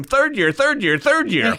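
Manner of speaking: high, loud frantic call; calling the senior resident in alarm; panicked calling out. Retold or imitated. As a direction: yell for help in a panic.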